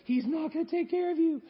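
high, teasing temptress voice; seductive desire. Acted. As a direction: coax him with sly doubt. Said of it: "And she's like".

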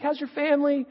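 high-pitched student small talk; imitating chatty students. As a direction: play a friendly student catching up. Students make small talk with teacher.

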